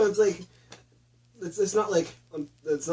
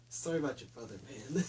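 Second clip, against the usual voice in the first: much softer, offhand mumble; flat mumbled apology; 4 semitones lower, 14 dB quieter than usual. Retold or imitated. Jake apologizes to the widow, soft and offhand. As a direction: toss it away quietly. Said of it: "He's like".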